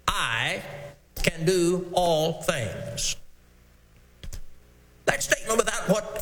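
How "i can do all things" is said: "I" set apart, then "can do all things" slow and weighty; stress on I. Shown as a demonstration. Preacher to congregation, stressing "I" slowly. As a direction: lean hard on "I", pause, then slow the rest. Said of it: "we first want to emphasize".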